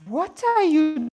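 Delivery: high, loud burst at the microphone; sudden loud, high outburst; loud exclamation. Real, not acted.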